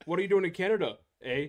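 high, loud mock Canadian; Canadian accent. Co-host quoting a border scene, Canadian accent. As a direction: imitate a sitcom Canadian border challenge. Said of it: "All I can ever think with Canadian accents".

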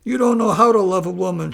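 wife shooting back loudly at her husband; angry retort. Acted. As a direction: fire it straight back at him. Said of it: "shot back Wonderful Flower".

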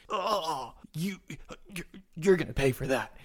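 acted groan into a halting threat; wounded threat. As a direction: threaten through a gut wound. Stabbed man vows revenge.

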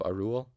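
"Arul" with oo, then uh; assumed Welsh pronunciation. Shown as a demonstration. Narrator settles on the difficult name, assumed Welsh pronunciation. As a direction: sound out "Arul" as Ar-oo-uhl. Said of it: "the W is a oo sound and the Y is".

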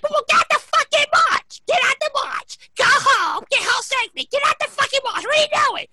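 high-pitched mock mom shouting; frantic mother impression. Mom orders son out of the march, high frantic scolding.

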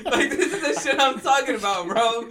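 high, laughing through the words; can barely get it out for laughing. Real, not acted. Cracking up at a work story.

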